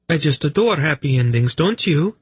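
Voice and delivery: put-on high, cheery young lady; bright. A narrator's reading. Velvet delighting in the happy ending, brightly. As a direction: chirp it brightly, pitched well up. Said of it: "Velvet said brightly".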